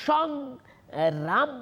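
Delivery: সংগ্রাম pulled slowly apart as সং and gram; two closed syllables. Shown as a demonstration. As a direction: hold সং, break, then gram. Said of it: "দুটো দল হচ্ছে".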